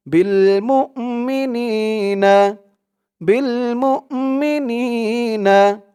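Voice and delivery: slow chanted recitation; tajweed-style reading; Quran recitation. Shown as a demonstration. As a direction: draw out মুমিনিনা in a recitation tune. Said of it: "teacher recites after spelling out".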